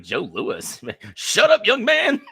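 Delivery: put-on high, loud old-timer rebuke; old barbershop guy. Old man at the barbershop silencing a young man, hammed-up. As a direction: shout down the youngster, laughing through it. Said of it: "talking shit to young people".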